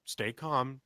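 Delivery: soft, understated reassurance; mock calming. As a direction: play it cool for someone panicking. Feigning calm to panicking wife.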